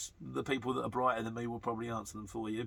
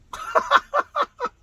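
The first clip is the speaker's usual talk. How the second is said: laugh; 11 dB louder than usual. Real, not acted. Laughs at a viewer's joke.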